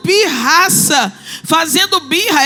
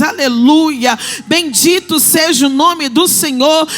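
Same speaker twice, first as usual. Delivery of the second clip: loud, driving sermon climax; preaching at full force; 5 dB louder than usual. Acted. Preacher declaring God's call over the church, loud and fervent. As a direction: build the fervour to a peak.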